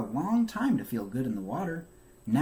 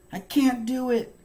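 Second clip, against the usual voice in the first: high, loud voice of a little turtle who gives up; Franklin giving up; 11 semitones higher, 6 dB louder than usual. A narrator's reading. Franklin to his mother after falling, high frustrated wail. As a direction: wail it like a discouraged child. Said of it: "he said".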